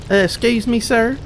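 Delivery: put-on high, timid kid voice; a lightly voiced shy little kid; child voice. Kid character tugs priest's robe.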